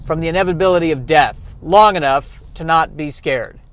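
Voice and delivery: loud, emphatic acted inner voice; exasperated brain. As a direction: play your own brain laying down a frantic plan. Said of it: "Maybe it's my brain going".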